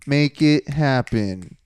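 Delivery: slow, drawn-out emphatic orders; imperious mock tyrant; domineering boss. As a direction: lay down the law, stretching the key words. Plays boss ordering museum staff.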